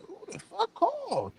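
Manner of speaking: very high, thin, hushed squeal; mock-panicked in a soft falsetto; alarmed inner reaction. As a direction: say it tiny and far up high. Retells own reaction to mother's call.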